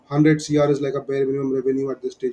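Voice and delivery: ordinary talk, nothing marked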